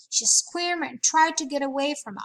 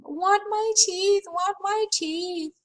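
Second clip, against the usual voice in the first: put-on toddler scream; 8 semitones higher, 4 dB louder, slower than usual. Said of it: "Fudge started to scream".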